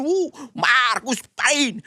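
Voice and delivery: high "Woo!" like a cheering rally crowd; a hammed-up rally cheer; mock cheering fan. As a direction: imitate the Marcos fans cheering. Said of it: "todo sila kay Marcos nun".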